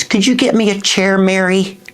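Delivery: put-on high, breathy old starlet voice; faded Hollywood diva asking sweetly; Elizabeth Taylor. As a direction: play a frail, high-voiced screen legend. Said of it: "and she says".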